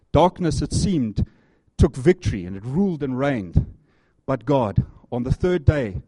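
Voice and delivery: loud, building sermon climax; full-force preaching. Acted. Preacher to congregation about the cross, loud and fervent. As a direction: preach it out with power.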